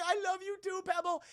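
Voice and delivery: put-on little girl voice. Little daughter Pebble to grandma, high child voice. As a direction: play a tiny girl hugging grandma. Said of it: "And she's like".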